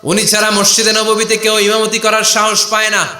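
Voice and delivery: high, sustained sermon chant; impassioned preaching. Acted. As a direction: carry the tale on a high ringing note.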